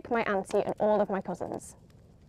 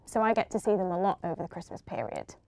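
usual speaking voice

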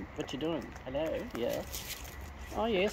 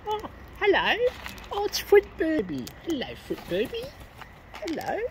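much higher pet greeting; high greeting coo; 10 semitones higher, 3 dB quieter than usual. Acted. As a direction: greet the bird in a sweet high voice. Greeting a new bird.

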